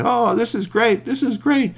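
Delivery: high, loud delighted cheer; excited badgers. Badgers cheering the tunnel, high and excited. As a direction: cheer like a thrilled little badger.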